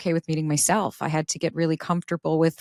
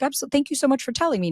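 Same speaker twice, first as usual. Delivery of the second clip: partner's grateful reply; 8 semitones higher, much faster than usual. Retold or imitated. Quoting partner's grateful answer.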